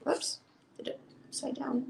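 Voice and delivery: soft whispered aside; whispering. Real, not acted. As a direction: whisper it to yourself. Aside while flipping the book.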